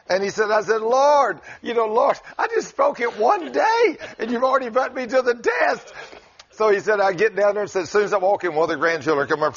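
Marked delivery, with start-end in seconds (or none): 0.8-5.9 high-pitched, hammed-up protest to the Lord; agonized plea to God. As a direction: complain to God in mock agony, pitched way up. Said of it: "I am in agony".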